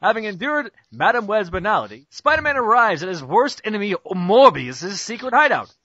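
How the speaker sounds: usual speaking voice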